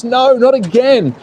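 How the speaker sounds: high, drawn-out cry of dismay; dismayed exclamation. Real, not acted. Commentator reacting to cars out of the race, dismayed. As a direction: exclaim it high and slow in disbelief.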